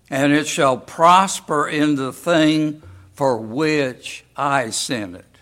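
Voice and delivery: slow, measured Bible reading; scripture reading. Acted. Preacher reads Isaiah aloud to the congregation, slow and solemn. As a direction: read the verse with weight, slowly.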